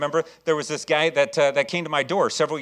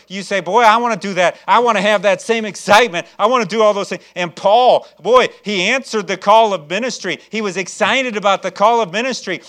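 higher, louder, rousing pulpit delivery; fervent preaching; 3 semitones higher, 10 dB louder than usual. Real, not acted. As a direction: preach it with full-force enthusiasm. Preaches on excitement for ministry.

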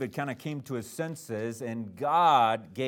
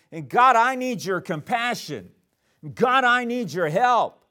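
louder, higher cry to God for help; pleading prayer; 6 semitones higher, 6 dB louder than usual. Acted. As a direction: plead with God, building in force. David's pleading prayer voiced.

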